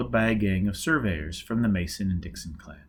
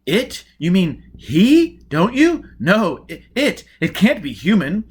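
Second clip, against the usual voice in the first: loud, high, aghast exclamations; a horrified crowd member crying out; loud high appalled gasps and protests; shocked spectators; 8 semitones higher, 9 dB louder, slower than usual. Acted. Spectators react to the speech.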